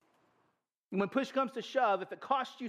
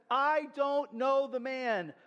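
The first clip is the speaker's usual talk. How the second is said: slow, weighty denial; slow public denial; 6 semitones higher, much slower than usual. Acted. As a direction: drag out each word of the denial. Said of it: "And he says".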